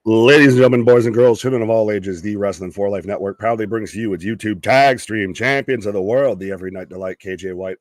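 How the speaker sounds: grand entrance announcement. Acted. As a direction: introduce the champ like a ring announcer. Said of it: "that's my best bud".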